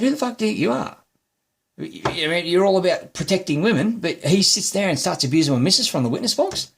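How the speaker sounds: high-pitched heated re-enactment; indignant outburst. Retold or imitated. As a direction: argue back at the magistrate, outraged. Retold outburst at magistrate.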